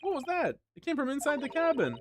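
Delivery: high-pitched startled reaction. Acted. Reacting to a scream from the cabin, startled. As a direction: react in alarm, pitch jumping up.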